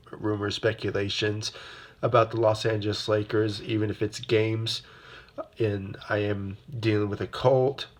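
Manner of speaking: usual speaking voice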